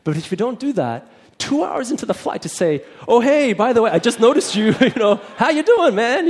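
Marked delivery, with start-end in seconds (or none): 3.1-6.3 high, loud, overeager small talk; mock a forced, out-of-nowhere hello; awkward late greeting. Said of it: "it's very awkward".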